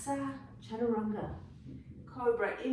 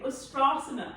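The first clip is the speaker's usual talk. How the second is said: Ustrasana with a hammed-up South African twang, a little higher and louder; 4 semitones higher, 5 dB louder than usual. Shown as a demonstration. Yoga teacher jokes to class in South African accent. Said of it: "I say that Ustrasana with a real South African twang".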